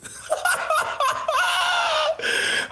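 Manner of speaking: high-pitched cackle; long high laugh. Real, not acted. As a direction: squeal with laughter. Laughing at his own joke.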